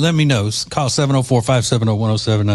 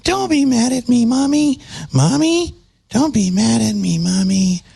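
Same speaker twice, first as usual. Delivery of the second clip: put-on much higher, pleading child voice; whiny little girl; 11 semitones higher than usual. Mocking her apology as a little girl begging Mommy. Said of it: "like a little girl".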